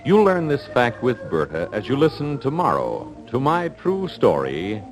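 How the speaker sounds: ordinary talk, nothing marked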